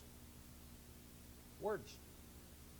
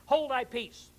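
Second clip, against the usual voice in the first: stern rebuke; 5 semitones higher, 6 dB louder than usual. Acted. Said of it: "Jesus rebuked him and said".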